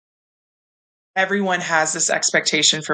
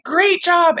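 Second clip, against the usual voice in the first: much higher, bright praise; high excited praise; 13 semitones higher than usual. Retold or imitated. Mom praising daughter after the race, excited. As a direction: cheer her on.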